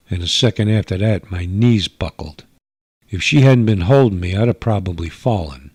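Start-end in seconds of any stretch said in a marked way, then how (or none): none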